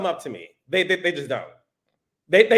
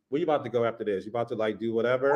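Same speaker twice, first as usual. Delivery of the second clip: much lower and quieter seductive bro; low, smooth pickup voice; deep masculine voice; 11 semitones lower, 9 dB quieter, much faster than usual. Shown as a demonstration. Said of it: "I would grab your hand and be like".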